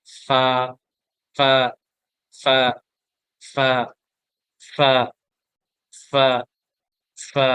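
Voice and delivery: short stopped ফা repeated; sakin drill. Shown as a demonstration. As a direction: stop on ফা each time. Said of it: "নোক্তা দেই". (থেমে থেমে ফা)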